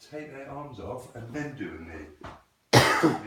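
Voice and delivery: loud, slow coaching emphasis; emphatic correction. Shown as a demonstration. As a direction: hammer home the mistake word by word. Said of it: "It's not".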